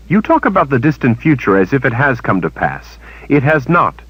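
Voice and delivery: a hint of a defiant young woman; Kalen, defiant. A narrator's reading. Kalen answering the witch, lightly voiced and defiant.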